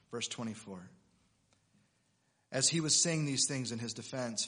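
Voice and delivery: normal talking voice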